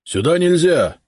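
high, loud stern policeman; stern call. A narrator's reading. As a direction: bar the way sharply. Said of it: "строго окликнул". (строгий окрик)

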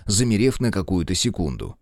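ordinary delivery, nothing marked

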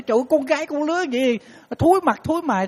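high, shrill mock scolding of a daughter; scolding mother. Mother scolding her daughter, mock outraged and high. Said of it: "bà nói".

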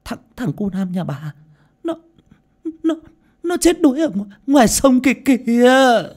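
high stammer building to a loud wail; breathless, halting, then shrieking; panting, panicked report. A narrator's reading. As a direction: gasp out the bad news, then cry it out loud. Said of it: "thở hồng hộc một hồi mới lấy lại sức".

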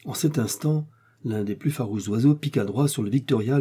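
plain reading voice

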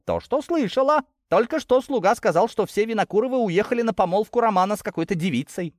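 high-pitched, spiteful gossip; venomous. A narrator's reading. Girl passing bad news to her cousin, venomous. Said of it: "продолжала пылать ядом Кристина".

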